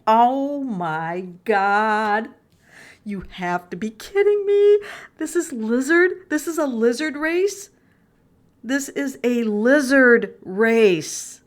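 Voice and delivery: can't believe what the card shows; stunned disbelief. Real, not acted. To viewers, shocked by a lizard in the card, astonished.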